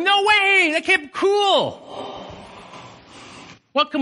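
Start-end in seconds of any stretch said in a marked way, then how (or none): 0.0-1.8 shouting. Retold or imitated. Preacher shouting his joy to the congregation. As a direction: cry out in astonished joy. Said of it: "you're shouting".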